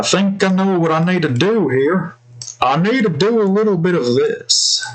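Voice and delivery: hint of a cute girl character; lightly put-on character voice; lightly voiced anime girl. Shown as a demonstration. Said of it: "I can still do other voices here".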